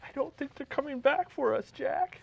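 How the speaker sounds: a man pitching up to play Rose. Acted. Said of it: "I'm gonna play the part of Rose".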